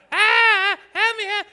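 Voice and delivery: high-pitched mock scream; hammed-up high frantic plea; excited cry for help. Shown as a demonstration. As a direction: shriek it like a damsel in danger. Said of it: "you gotta get excited".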